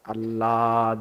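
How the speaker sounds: ordinary talk, nothing marked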